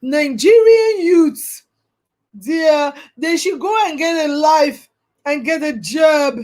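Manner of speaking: slow, drawn-out mock of a dismissive official; slow sneering imitation of a scornful official; mocking quote. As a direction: parody her condescending tone, stretching each word. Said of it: "And you are there saying".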